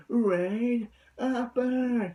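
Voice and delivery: drawn-out mock anime attack call; imitating Hyuga. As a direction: stretch the call like the character. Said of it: "he sounds like Hyuga".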